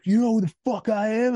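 bus driver's high, loud challenge; retold scream. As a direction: yell it as the outraged driver. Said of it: "he was, like, screaming at me".

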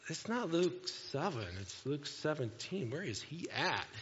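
slow, soft, puzzled mock congregant; puzzled searching. Congregant checking the preacher's reference in the Bible, puzzled. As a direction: imitate someone lost in the pages, drawn out and quiet.

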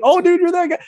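excited stranger. Retold or imitated. Strangers recognizing him at the bar.

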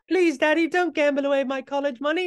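put-on very high pleading Cockney girl; high-pitched Cockney child begging; Cockney daughter voice. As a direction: beg Daddy in a squeaky Cockney accent. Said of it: "why does my daughter have a Cockney accent".

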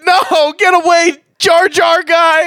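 high, loud wail of protest; panicked shout. Pretend. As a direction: frantically drive off an intruder. Fending off the unwanted house guest.

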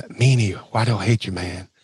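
a low-key mock grievance; Tracy Smothers impression. Imitating Tracy Smothers' catchphrase.

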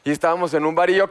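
normal talking voice

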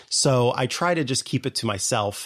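ordinary talk

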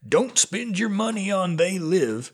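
put-on high twangy old-timer warning; old prospector. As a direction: croak it like a grizzled gold miner. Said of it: "I spoke in the voice of an old prospector".

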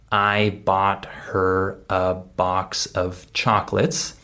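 every word separate and fully sounded; slow, word-by-word model sentence with a full "of"; slow careful diction. Shown as a demonstration. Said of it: "if I say this at normal speed".

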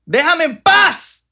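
high, loud, dragged-out plea; mock anguished wail. Host wailing at MLM recruiters to leave him alone, high and drawn out. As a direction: beg them to leave you alone, hammed up.

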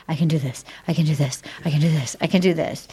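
low, rapid retold mantra; imitating a man chanting to himself; repeated self-pep-talk. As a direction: repeat it low and even, like psyching yourself up. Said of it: "seven times".

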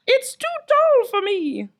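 high, slow, whiny voice; mock Romeo. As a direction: whine up at the tower. Romeo answers from below.

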